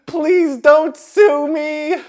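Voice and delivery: high, slow, loud begging; pleading wail. A narrator's reading. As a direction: beg desperately, dragging the words. Ed begs the manager.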